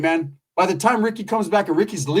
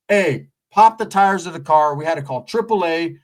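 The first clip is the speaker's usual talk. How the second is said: usual speaking voice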